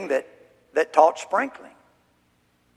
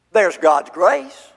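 normal talking voice

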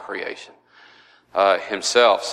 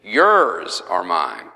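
"Yours" drawn out long, then falling to "are mine"; very slow, lower "Yours are mine", weight on "Yours"; stress on YOURS; 4 semitones lower, much slower than usual. Real, not acted. Teacher quoting Jesus' next phrase to the Father, stress on "Yours".

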